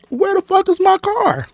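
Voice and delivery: very high, alarmed questions; wait, where is it; panicked disbelief. Retold or imitated. Realizing the car is gone, very high alarm.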